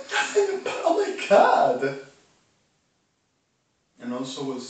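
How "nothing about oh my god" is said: outraged exclamation. Real, not acted. Reacting to what Obama said, in loud disbelief. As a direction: cry out in disbelief.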